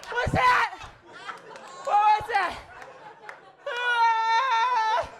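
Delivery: high loud wonder-struck shouts; a baby mid acid trip, amazed; tripping baby. Retold or imitated. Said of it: "They're just tasting things that aren't there".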